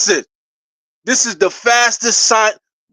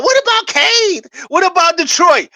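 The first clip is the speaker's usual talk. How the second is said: mocking the doubters; 7 semitones higher than usual. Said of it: "Everybody talking about".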